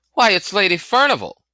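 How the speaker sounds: high, loud, amazed that anyone could ask; astonished boy. A narrator's reading. Boy astonished that the stranger doesn't know the lady, high and loud. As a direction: blurt it out as if everyone knows. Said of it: "He gave me a look of astonishment".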